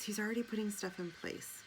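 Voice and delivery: ordinary talk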